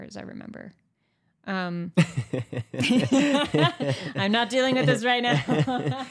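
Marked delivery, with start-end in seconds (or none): 2.0-4.1 light bursting giggle; giggling laugh. Real, not acted. Laughing at frustrated dating joke. 4.2-6.1 talking through laughter; laughing. Real, not acted. Joking exasperation while laughing.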